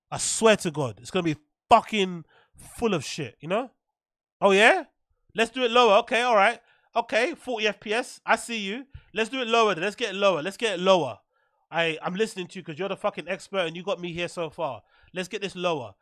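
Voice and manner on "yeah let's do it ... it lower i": ordinary delivery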